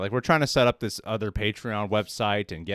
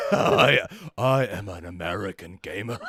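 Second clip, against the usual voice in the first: laughing, put-on American accent; Gerard Butler; 4 semitones lower than usual. Co-host topping host's film-title gag, bad American accent. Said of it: "Gerard Butler does say the title".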